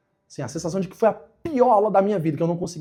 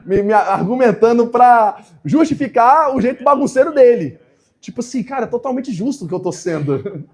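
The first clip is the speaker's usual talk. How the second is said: laughing through the words, higher and louder; amused laughing; 7 semitones higher, 9 dB louder than usual. Real, not acted. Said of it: "Olha a lógica do menino".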